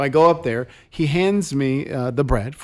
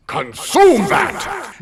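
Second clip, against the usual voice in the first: high, loud, slow commanding bark; priest's stern command; 6 semitones higher, 3 dB louder, much slower than usual. Retold or imitated. Priest repeats his order to the frozen churchgoer, commanding bark. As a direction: bellow the order from the altar. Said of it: "And again, he says".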